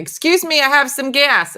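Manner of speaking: loud, high "Excuse me, I have some gas"; loud, high toddler announcement; toddler announcing. Retold or imitated. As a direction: announce it proudly, as a toddler running round the house. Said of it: "started announcing it".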